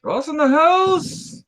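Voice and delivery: high, drawn-out hype greeting; sing-song welcome, stretched way up high; playful shout-out. Pretend. Hyped greeting to chat arrival.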